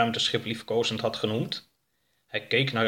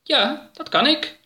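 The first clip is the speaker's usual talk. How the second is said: very high, slow, louder alien voice; alien Oroka answering; 14 semitones higher, 5 dB louder, slower than usual. Acted. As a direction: answer proudly and deliberately.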